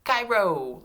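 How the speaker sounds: Cairo high and loud, in one piece; high, loud Cairo with a falling end; name called out. Shown as a demonstration. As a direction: call out Cairo whole for the class to echo. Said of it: "say this name with me".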